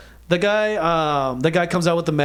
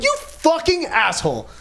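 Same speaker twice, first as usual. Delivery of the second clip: worked-up protest, high but not shouted; retold outrage; 10 semitones higher than usual. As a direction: lean on each word in acted exasperation. Retelling his outburst at the bouncer.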